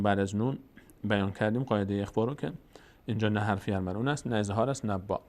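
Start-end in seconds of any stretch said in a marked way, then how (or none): none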